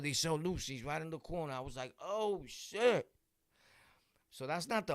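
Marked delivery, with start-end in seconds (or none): 2.0-3.1 a sudden high, loud "oh shit" of shock; startled curse. Retold or imitated. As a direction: blurt it out, caught off guard.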